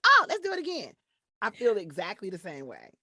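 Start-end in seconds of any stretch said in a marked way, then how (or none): 0.1-0.9 high, loud burst of excitement; thrilled rider. Acted. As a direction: shout it like someone just off a roller coaster. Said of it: "when you go down, you're like".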